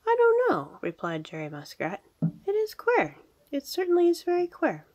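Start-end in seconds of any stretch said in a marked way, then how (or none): 0.1-0.8 very high, loud character voice; storybook muskrat, high-pitched and baffled; puzzled young muskrat. Acted. 2.5-4.9 very high, loud character voice; storybook muskrat, high-pitched and baffled; puzzled young muskrat. Acted.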